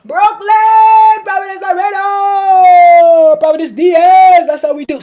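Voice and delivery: loud, high, drawn-out shout of pride; Brooklyn shout-out. Real, not acted. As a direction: belt it out like a hometown chant. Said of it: "getting into my Brooklyn accent".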